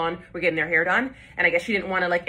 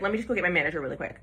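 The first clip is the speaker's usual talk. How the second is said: rushed excuse; 3 semitones lower, 4 dB quieter, much faster than usual. Retold or imitated. Stylist rushing off to fetch the manager, fast. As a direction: rattle it off fast, a touch evasive. Stylist hurrying off to avoid blame.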